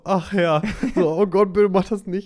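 high, loud mock plea to God; imitated dramatic pleading. Teasing the reader's intonation of Jeremiah begging God, mock pleading. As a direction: mimic an exaggerated, reluctant protest. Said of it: "wie deine Betonung sofort so".

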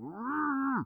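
high tight hum through closed lips; lip-bitten frustrated hum. Retold or imitated. Dad imitating his upset daughter, tight high hum. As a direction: hum like a child holding in anger. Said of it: "frustrated face is, like, biting her lip".

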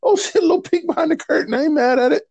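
high squealing laugh through the words; giggling mock shock; laughing reaction. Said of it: "I was like".

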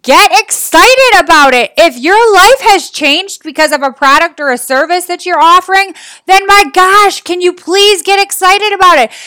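excited urging. Real, not acted. Coach begging sellers to show some enthusiasm, excitedly urging. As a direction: push them to get fired up.

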